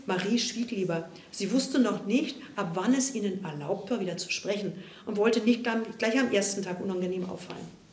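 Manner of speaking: plain reading voice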